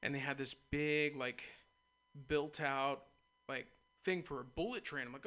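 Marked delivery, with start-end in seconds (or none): none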